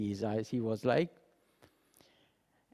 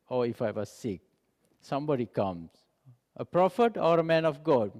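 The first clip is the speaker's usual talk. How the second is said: usual speaking voice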